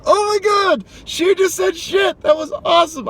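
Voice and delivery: very high delighted squeal; thrilled shock. Retold or imitated. Driver hearing old lady cuss, high thrilled squeal. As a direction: squeal it like you can't believe your ears.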